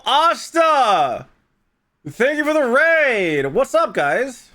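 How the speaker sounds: loud, high streamer welcome; excited greeting. Real, not acted. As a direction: hype up the raiders. Greeting an incoming raid.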